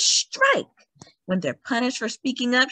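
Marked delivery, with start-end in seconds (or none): 0.0-0.7 high, ringing call to strike; rally the seamstresses; rallying cry. Acted. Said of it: "she says, "Strike!".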